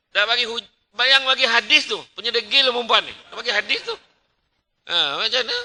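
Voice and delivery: loud, high frustrated rant; fed-up preacher raising his voice; exasperated scolding. Real, not acted. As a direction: scold the stubborn crowd in disbelief.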